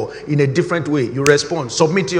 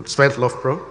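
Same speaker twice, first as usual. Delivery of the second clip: usual speaking voice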